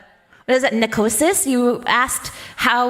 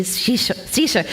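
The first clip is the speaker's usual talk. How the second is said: usual speaking voice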